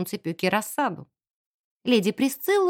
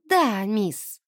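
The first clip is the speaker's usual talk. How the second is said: ordinary delivery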